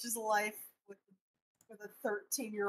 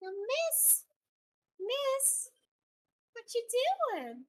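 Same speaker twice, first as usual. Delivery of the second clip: high, drawn-out pet-talk; calling the dog; 11 semitones higher, much slower than usual. Real, not acted. Owner calling old dog, high sing-out.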